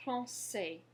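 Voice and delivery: Français, ç as s, final s silent; Français, nasal an, silent s; French français. Shown as a demonstration. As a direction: model Français clearly. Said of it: "Go ahead".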